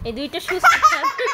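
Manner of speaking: high and loud, laughing through the words; a laughing, teasing jab; laughing tease. Real, not acted. Mother teasing her two restless boys, laughing. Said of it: "teasing the two boys".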